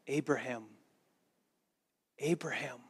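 soft, hushed "Abraham, Abraham"; quiet, solemn divine call; God's call. Retold or imitated. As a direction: call his name gently from far above. God calling Abraham to stop.

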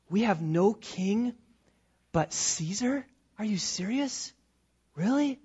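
soft questioning echo, rising at the ends; incredulous. Acted. Listener stunned by the priests' answer, incredulous. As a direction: repeat it back in disbelief. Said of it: "You have got to be kidding me".

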